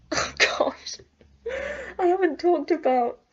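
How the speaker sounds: whispered gasp into high, tearful voice; real tears breaking into the words; getting emotional. Real, not acted. Remembering a boy not talked about for ages, tearful. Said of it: "I'm getting emotional just thinking about it".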